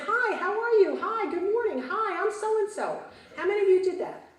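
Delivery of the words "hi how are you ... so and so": bright greeting. Retold or imitated. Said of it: "with a big smile and said".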